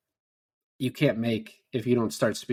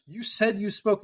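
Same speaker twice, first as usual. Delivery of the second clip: high-pitched imitated cop pressing a claim; insistent accusation; 9 semitones higher than usual. Cop trying to get people to say it, insistent. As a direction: push him to own his words. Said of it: "he's trying to get people to say what he wants".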